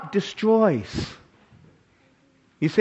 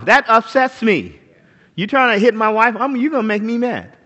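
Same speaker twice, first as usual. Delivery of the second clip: usual speaking voice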